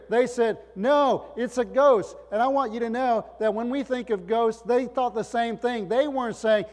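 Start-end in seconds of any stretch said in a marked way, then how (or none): 0.8-2.2 loud, high cry of alarm; preached-up mock alarm. Disciples' alarmed cry acted out.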